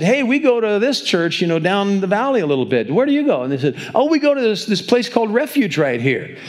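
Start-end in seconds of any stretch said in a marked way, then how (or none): 0.0-3.4 chatty friendly. Retold or imitated. Restaurant couple chatting brightly. 4.0-6.4 chatty friendly. Retold or imitated. Other couple answers brightly.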